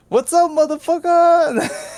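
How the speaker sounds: high, drawn-out hollered greeting; gleeful taunting shout. Retold or imitated. Prankster revealing himself to his cousin, gleeful loud taunt. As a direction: holler it high and long, triumphant.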